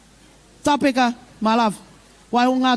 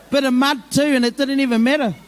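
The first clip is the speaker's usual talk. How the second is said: ordinary talk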